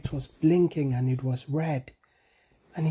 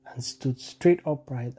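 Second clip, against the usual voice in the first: usual speaking voice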